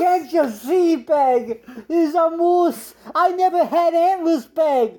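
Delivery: high, slow desperate plea; pleading husband. Acted. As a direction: beg your wife to see the difference. Pleads with his wife.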